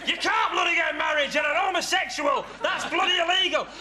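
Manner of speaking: angry shouting. Acted. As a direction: bark it at them, outraged. Flatmate yells at Nathan.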